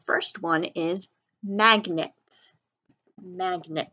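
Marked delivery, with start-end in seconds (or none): none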